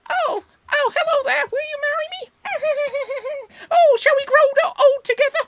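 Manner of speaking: very high, squeaky, fast voice; squeaky old man voice. Acted. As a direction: squeal it like a cartoon oldie. Acts out Up in a minute.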